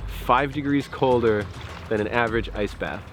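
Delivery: ordinary talk, nothing marked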